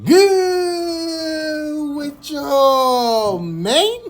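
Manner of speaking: very slow, stretched-out hello; drawn-out greeting. Real, not acted. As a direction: stretch the vowels way out. Drawn-out opening greeting to viewers.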